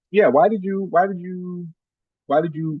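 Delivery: usual speaking voice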